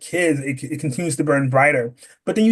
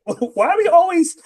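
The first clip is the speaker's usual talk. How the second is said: mock complaint; 4 dB louder than usual. Child objecting to always being volunteered, exaggerated high complaint.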